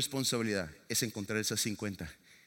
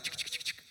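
toquecitos imitados; 9 dB quieter than usual. Retold or imitated.

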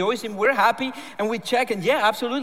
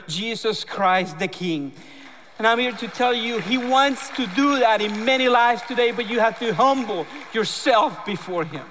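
preaching; 4 semitones higher than usual. Acted. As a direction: preach it with building fervor. Preaching the gospel with rising force.

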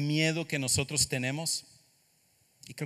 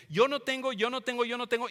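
higher, louder repeated cry; emphatic lament; 8 semitones higher, 4 dB louder than usual. Acted. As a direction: hammer the phrase, rising in despair. (repite con fuerza, más alto y con desesperación)

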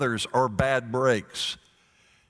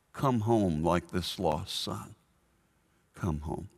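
gentle invitation; 5 semitones lower, 5 dB quieter than usual. Acted. God's invitation to all.

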